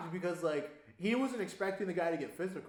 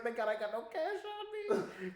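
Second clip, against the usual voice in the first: laughing through a relieved joke, slowed; 14 semitones higher, slower than usual. Retold or imitated. To the listener, laughing at his own luck: amused and slow. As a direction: chuckle it out, relieved to have no cash.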